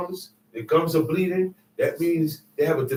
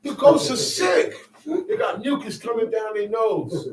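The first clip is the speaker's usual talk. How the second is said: ordinary talk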